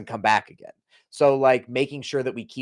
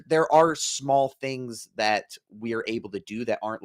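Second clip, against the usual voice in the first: ordinary talk, nothing marked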